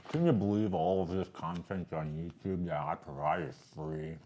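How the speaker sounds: talking through a mouthful; words round a bite of candy; mouth full. Real, not acted. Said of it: "You talk with your mouth full".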